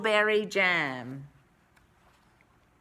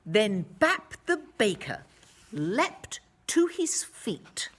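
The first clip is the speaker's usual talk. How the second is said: plain reading voice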